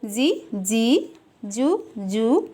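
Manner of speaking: slow, stretched-out syllables for the class; slow recitation. Shown as a demonstration. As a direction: spell each syllable out slowly for the children. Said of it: "अब इसको क्या पढ़ेंगे?". (बच्चों के लिए धीमा उच्चारण)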